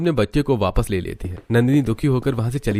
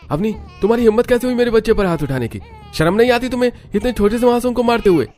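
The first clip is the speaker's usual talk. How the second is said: very high, loud, fast enraged yell; furious shout; 13 semitones higher, 5 dB louder, faster than usual. Acted. Husband Vikas yelling at Avni for raising her hand, furious. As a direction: explode at her.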